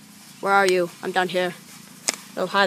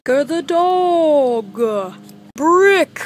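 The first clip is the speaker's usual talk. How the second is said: much higher, slow stretched announcement; drawn-out credits call; 6 semitones higher, 6 dB louder, much slower than usual. Acted. Kid announcing the cast, stretched announcer call. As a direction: stretch the name out like a big reveal.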